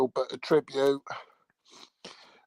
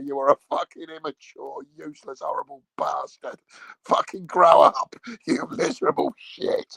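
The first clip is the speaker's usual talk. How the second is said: hammed-up outrage, higher and building louder; mock furious telling-off; 6 semitones higher, 6 dB louder than usual. Partner scolds the prankster after slapping him, mock furious. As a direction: play an appalled partner laying into him.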